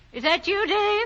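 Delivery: calling out. Acted. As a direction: call out to someone just arriving.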